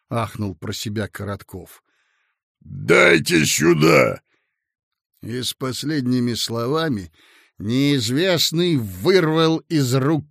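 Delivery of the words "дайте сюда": slow, loud, barked order; snatch it with a bark; commanding demand. A narrator's reading. The bald boss snatches paper.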